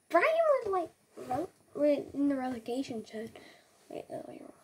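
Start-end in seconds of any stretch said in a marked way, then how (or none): 3.9-4.5 hushed, breathy aside as if not meant to be heard; cheeky whisper. Acted. Gamer whispers a rude aside about Leeds and Everton, cheeky whisper. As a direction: whisper the insult behind your hand.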